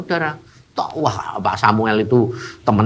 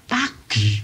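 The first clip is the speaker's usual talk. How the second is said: ordinary talk, nothing marked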